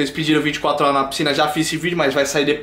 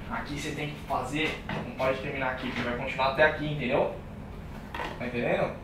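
quiet; 5 semitones lower, 12 dB quieter than usual. Real, not acted. Quietly correcting partner's build.

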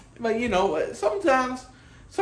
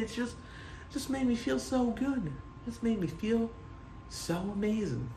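hushed awe; 4 semitones lower, 9 dB quieter than usual. Real, not acted. Dreamy quiet recollection.

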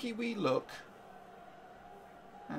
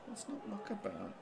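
muttering the plea under the breath, softer; quiet mutter; 4 semitones higher, 10 dB quieter than usual. Real, not acted. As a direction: drop right down, half to yourself.

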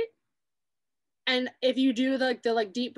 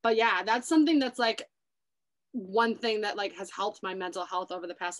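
normal talking voice

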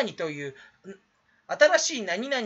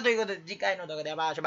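rushed sign-off; much faster than usual. Acted. YouTuber speeding through his usual sign-off, rushed. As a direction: rattle off the goodbye very fast.

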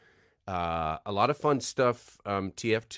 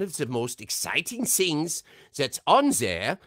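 put-on theatrical German-accented build-up, higher in pitch; German Medic voice; 6 semitones higher than usual. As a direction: tease the reveal as a German character. Slips into German Medic for announcement.

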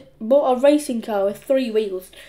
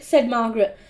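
ordinary delivery, nothing marked

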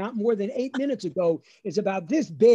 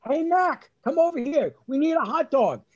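imitated dad hailing a vendor, higher; yelling out; 7 semitones higher, 4 dB louder than usual. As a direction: call out across the stands. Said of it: "my dad yells out".

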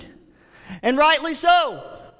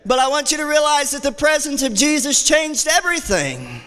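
ordinary delivery

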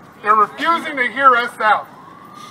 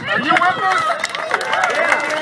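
high, slow, drawn-out call to the crowd; rallying call; 8 semitones higher, much slower than usual. Real, not acted. Legislator rallying supporters before a hearing, drawn-out high call. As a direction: stretch it out and lift it to the crowd.

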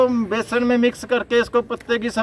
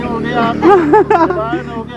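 hyped vendor call; 6 dB louder, much slower than usual. Pretend. Vendor hyping produce.

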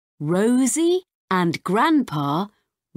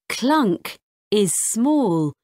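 usual speaking voice

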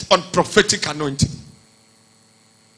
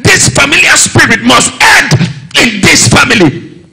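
forceful shouted refusal, louder and higher; loud protest; 5 semitones higher, 10 dB louder than usual. Acted. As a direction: cry out against the pattern. Joseph's voiced protest in sermon.

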